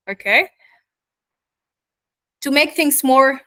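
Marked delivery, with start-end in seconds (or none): none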